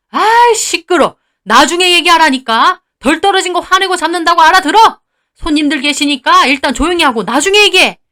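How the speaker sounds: high, loud scolding; annoyed shushing. Acted. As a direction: shut the wife up. Father-in-law shuts wife up. (시끄럽다고 버럭 나무라는 말투)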